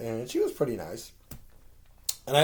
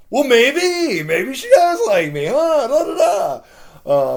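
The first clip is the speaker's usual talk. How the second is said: much higher, louder, slowed dreamy sing-song; giddy hopeful; 12 semitones higher, 13 dB louder, much slower than usual. Retold or imitated. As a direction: tease yourself with the hope she likes you. Giddy inner thought about crush.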